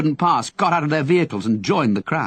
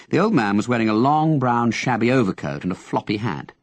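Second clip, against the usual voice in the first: ordinary delivery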